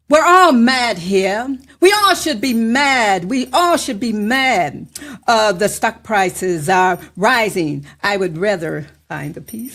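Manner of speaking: loud, slow, rising spoken word delivery; spoken word recital. Acted. Poet reciting her piece to the radio audience, declaiming.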